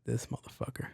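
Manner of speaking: breathy muttered whisper curse. Retold or imitated. Trapped caller curses operator who hung up, whispered. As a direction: spit it under your breath. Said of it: "And I'm like".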